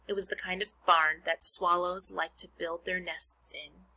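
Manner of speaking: put-on expressive read-aloud to children; reading a book to a class; story narration. Reads Charlotte's Web aloud.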